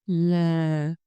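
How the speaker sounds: LA drawn out low, sinking; falling tone. Shown as a demonstration. Teacher models for repetition, LA low and sinking. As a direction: let LA slide down and stay low. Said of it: "down and stay low".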